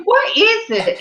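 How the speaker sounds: high-pitched insistent question; retold puzzled demand. Mama asking the laughing storyteller, puzzled and insistent. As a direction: imitate a baffled mama, voice way up.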